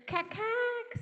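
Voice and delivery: high chattering cack-cack; magpie cackle. A narrator's reading. Storyteller chatters like the magpie for the kids. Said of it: "said the magpie".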